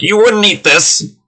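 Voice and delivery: indignant high-pitched goblin head chef; Chef Ramsay goblin. Acted. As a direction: berate the cooks in a goblin voice. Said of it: "He's basically Chef Ramsay, but in goblin form".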